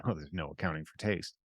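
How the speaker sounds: low, soft dismissive aside; imitating an offhand brush-off. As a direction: toss it off dismissively, dropped right down. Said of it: "people very dismissively will say".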